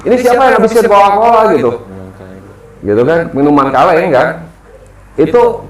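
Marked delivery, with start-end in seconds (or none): 0.0-1.4 high-pitched accusing call-out; mock shout, pitched well up; retold yell. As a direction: imitate a sibling yelling from the next tent. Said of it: "adik saya yang ... itu teriak juga".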